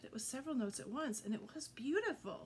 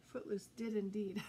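ordinary delivery

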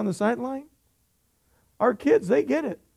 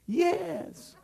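insistent yes; 8 semitones higher, 6 dB quieter than usual. Retold or imitated. Dad answers back in quarrel.

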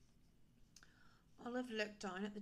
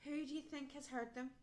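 ordinary delivery, nothing marked

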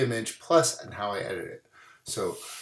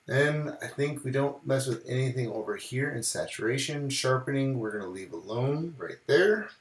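ordinary talk

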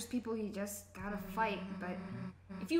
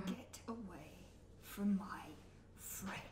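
slow whispered warning; whispered threat; 4 semitones lower, 12 dB quieter, slower than usual. Acted. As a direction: breathe the threat slowly. Protecting a friend from a villain.

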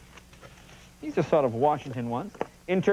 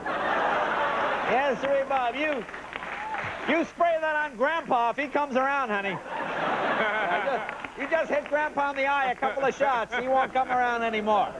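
high hammed-up salesman voice; mock pitchman; 8 semitones higher than usual. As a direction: sell the spray like a TV pitchman. Mock pitchman for pest spray.

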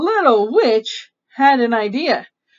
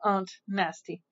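plain reading voice

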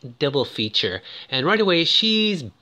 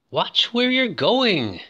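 mock scolding; 8 semitones higher, slower than usual. Scolds film character after bike crash.

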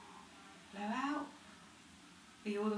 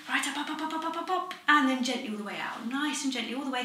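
rising higher, urging up, up; coaching encouragement; 4 semitones higher, 5 dB louder than usual. Real, not acted. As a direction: lift them to a full breath. Coaching breath in and out.

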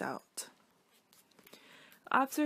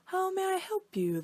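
much higher, helpful magical voice; shapeshifter voice; 10 semitones higher than usual. Acted. Shapeshifter greets the triangle, high and helpful. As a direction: sing it out like an eager wizard.

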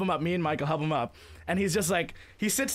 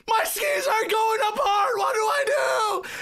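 high, drawn-out yell imitating a stranded skier; mock-panicked wail, much higher and louder; 14 semitones higher, 4 dB louder than usual. Skier yells for help halfway up the slope, panicked yelling. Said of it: "you hear him yelling".

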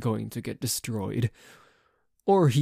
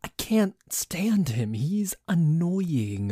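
usual speaking voice

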